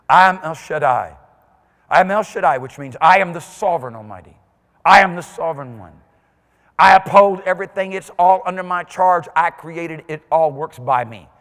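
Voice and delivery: loud, commanding divine voice; voice of God. Acted. God declaring himself to Abraham, commanding. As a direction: proclaim as the Almighty. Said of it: "Papa looks at Abraham and he says".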